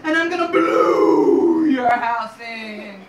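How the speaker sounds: loud, slow, drawn-out wolf threat; menacing big bad wolf. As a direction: bellow it, stretching the key word.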